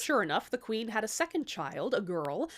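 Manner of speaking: ordinary delivery, nothing marked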